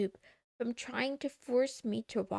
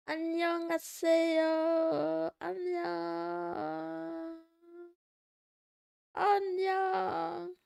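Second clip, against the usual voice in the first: cutesy greeting; 6 semitones higher than usual. Pretend.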